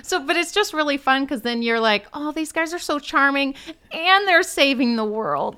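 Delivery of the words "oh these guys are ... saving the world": higher-pitched, swooning gush; gushing viewer. Acted. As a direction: gush like a smitten fan. Acting out a charmed viewer.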